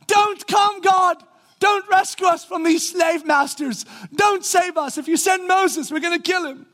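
mock shout. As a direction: yell it at God, way up in pitch. Mocking imagined cries of Israelites.

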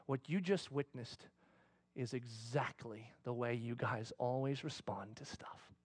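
soft, low, slow whisper. Retold or imitated. God to the pastor, a soft slow whisper. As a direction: breathe it like a quiet revelation. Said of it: "that little whisper comes".

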